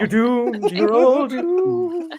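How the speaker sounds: high, slow, hammed-up doom warning; spooky horror-movie prophet voice; slow ominous raspy drawl. Mock threat to quiz players.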